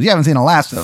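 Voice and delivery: hammed-up twenties private eye; 1920s detective. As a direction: vow revenge like an old movie detective. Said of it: "he talks like one too".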